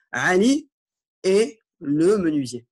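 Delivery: Ali et le menuisier said slowly, et stressed; slow model phrase. Shown as a demonstration. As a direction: drag out et le menuisier. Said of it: "Man hada".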